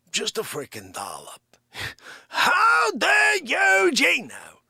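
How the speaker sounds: indignant character who keeps bursting into shouts; Dick Facce. Acted. Host's alter ego badgering Gino over espresso, blustering character voice. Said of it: "when Dick Facce takes over".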